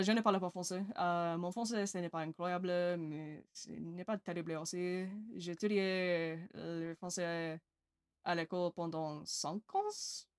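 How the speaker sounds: careful schoolroom French pronunciation; lightly accented French, slowed in places; school French. Shown as a demonstration. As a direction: speak French like a British student trying hard. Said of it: "did I say that right?".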